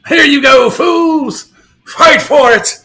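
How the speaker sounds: hammed-up gloating call; mock taunt. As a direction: tease them like a villain tossing scraps. Taunting buyers in put-on voice.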